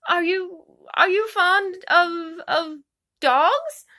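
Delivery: Alice nervously changing the subject to dogs; flustered stammer. A narrator's reading. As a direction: trip over the words nervously.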